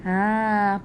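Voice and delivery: ordinary delivery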